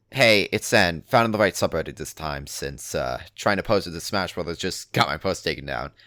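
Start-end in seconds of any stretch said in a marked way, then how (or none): none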